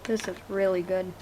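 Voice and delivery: murmured to herself while tasting; quiet. Real, not acted. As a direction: say it softly under your breath. Tasting a treat, murmured aside.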